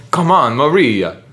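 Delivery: slow, loud mock Italian accent; accidental Italian accent. Shown as a demonstration. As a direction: Soviet accent slipping into Italian. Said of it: "You're going Italian".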